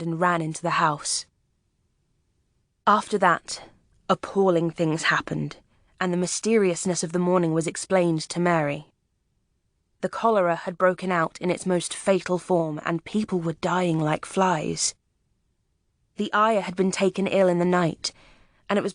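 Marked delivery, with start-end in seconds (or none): none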